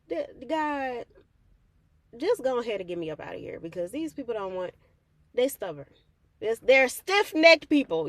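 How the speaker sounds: frustrated complaint rising to a loud outburst; exasperated plea. Retold or imitated. Speaker complaining to God about stubborn family, exasperated and rising.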